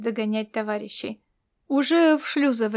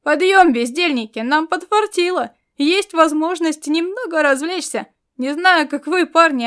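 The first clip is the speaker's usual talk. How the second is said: high, loud cheerful yell; cheerful shout; 5 semitones higher, 6 dB louder than usual. A narrator's reading. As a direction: rouse the squad with a happy shout. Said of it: "весело выкрикнул".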